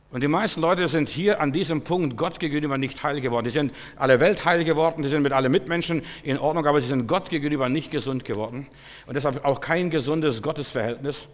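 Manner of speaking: lauter, höher und steigernd gepredigt; Predigt mit voller Kraft. Acted. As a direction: auf den Höhepunkt hin hinaufpredigen. Preaching at full force. (lauter, anschwellender Predigerton)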